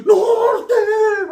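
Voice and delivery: high, loud, stretched-out yell; wailing gang shout. Acted. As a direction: holler it in a long crying wail. Yells gang call back while hurt.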